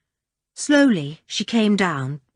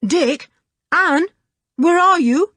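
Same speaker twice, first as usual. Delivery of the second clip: calling loudly; 10 semitones higher, 4 dB louder, slower than usual. Acted. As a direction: call out high into the dark wood. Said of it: "Julian called loudly".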